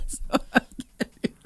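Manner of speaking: whispered laughing; breathless giggle. Real, not acted. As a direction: laugh it out, can't speak. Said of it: "I'm laughing too hard now".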